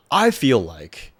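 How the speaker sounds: ordinary talk, nothing marked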